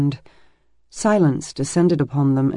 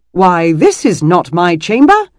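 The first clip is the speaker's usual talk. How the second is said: blurted louder in hammed-up shock; exaggerated surprise; 3 semitones higher, 7 dB louder than usual. A narrator's reading. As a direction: fake astonishment, covering embarrassment. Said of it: "with exaggerated surprise and blurted".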